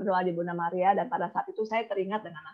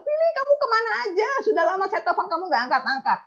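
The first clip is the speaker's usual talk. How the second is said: very high, loud reproachful call; imitated scolding outcry; 11 semitones higher, 4 dB louder than usual. Client greets agent who ignored her calls, high loud mock scolding. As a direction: cry out as if scolding someone long missing.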